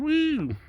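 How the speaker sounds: high, loud whoop; high excited rock whoop. Shown as a demonstration. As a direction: cap the song with a rock-star whoop. Whoop after singing the song.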